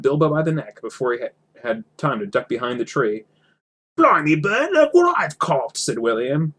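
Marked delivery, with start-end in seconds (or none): 4.0-5.8 much higher, louder troll crowing over his catch; a slow, high troll exclaiming; excited troll. Acted.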